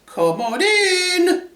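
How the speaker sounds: very high, drawn-out falsetto call; wolf posing as granny. Acted. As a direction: invite in with a fake granny trill.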